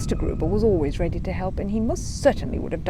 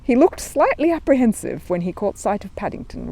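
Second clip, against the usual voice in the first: usual speaking voice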